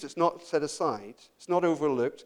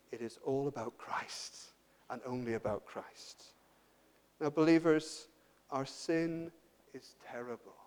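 soft, low, solemn appeal; hushed preaching; 5 semitones lower, 10 dB quieter than usual. Real, not acted. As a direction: plead slowly and quietly, leaving long pauses.

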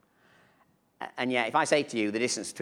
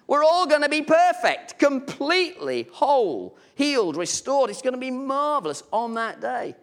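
raised, rousing pulpit voice; full-force preaching; 12 semitones higher, 6 dB louder than usual. Acted. Preacher stirring the church about heaven, rousing. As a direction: lift the congregation with the promise.

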